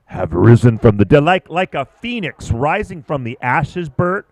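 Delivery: big booming build, dragging out the phoenix line; rally the crowd like a fight-night announcer; hype announcer. Acted. Announcer hyping the returning Immortals heat, booming build.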